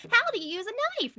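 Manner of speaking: high, loud mock lesson question; mock teacher. Joking that he teaches kids knife use, mock teacher. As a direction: ask it like a cheery class teacher.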